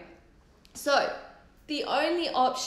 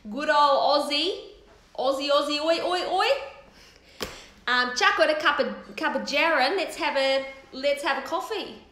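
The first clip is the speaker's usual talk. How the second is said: loud, higher, hammed-up Aussie accent; broad Aussie accent; 4 semitones higher, 5 dB louder than usual. Shown as a demonstration. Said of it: "I don't think I talk like that".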